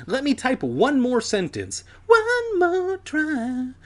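high, drawn-out pleading; eager plea. A narrator's reading. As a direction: stretch it out high and hopeful.

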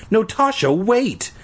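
put-on boy pleading with the editor, mild whine. A narrator's reading. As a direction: plead with her not to cut him, lightly acted. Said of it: "I pleaded".

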